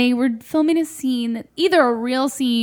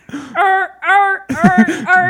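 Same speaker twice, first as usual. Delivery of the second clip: loud, high repeated "er" like jammed gears; gears-mashing noise; 7 semitones higher, 7 dB louder, much slower than usual. Retold or imitated. Brain failing at an Italian accent, gears-mashing noise. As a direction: grind out a stuck-brain noise. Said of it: "Gears mashing, smoke out the ears".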